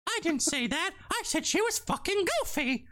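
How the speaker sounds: very high cartoon mouse voice; Mickey Mouse. Mouse character correcting himself, high cartoon voice. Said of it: "a conversation between Goofy and fucking Mickey".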